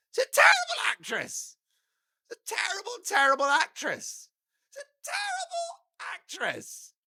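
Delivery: shrieking high-pitched scorn on terrible; squeaky outrage. Real, not acted. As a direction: fling it out in a squealing, disbelieving voice. Ranting that Meghan can't act.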